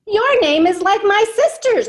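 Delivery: put-on a boy thrilled at a shared name; delighted boy. A narrator's reading. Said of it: "Rigoberto says".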